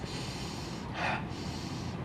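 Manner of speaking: long slow audible exhale; one long deep breath out. Retold or imitated. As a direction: let out a big breath. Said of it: "Take three deep breaths".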